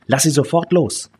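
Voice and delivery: high, indignant command; angry order. A narrator's reading. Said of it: "rief die Frau wütend". (wütend und hoch befehlen)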